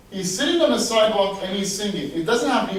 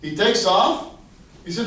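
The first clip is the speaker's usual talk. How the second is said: usual speaking voice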